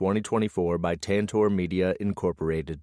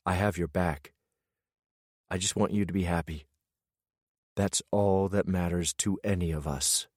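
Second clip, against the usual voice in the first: softer, hushed brotherly promise; gentle reassurance; 3 dB quieter than usual. Acted. Bo steadying his brother after a fierce hug, softly reassuring. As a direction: quietly tell him you've got his back.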